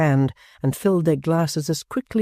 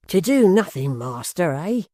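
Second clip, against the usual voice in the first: louder, higher mocking question; cried out; 5 semitones higher, 3 dB louder than usual. A narrator's reading. Hugh throws Gashford's words back at him, loud and mocking. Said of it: "cried Hugh".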